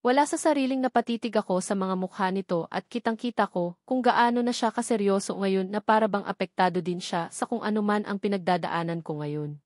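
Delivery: plain reading voice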